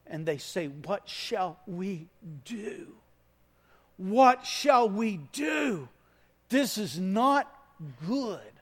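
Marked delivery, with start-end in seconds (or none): 0.7-3.0 slow, pleading question; slow troubled plea; anguished cry. Acted. Crowd's anguished question acted out. 4.0-8.5 slow, louder anguished outcry; high, loud, slow dismay; desperate cry. Acted. Crowd's anguished question acted out.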